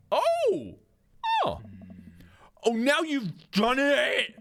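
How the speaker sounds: shrill, stretched-out dramatic gasp; over-the-top uh-oh; high mock alarm. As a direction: ham up the shock. The fight is about to start.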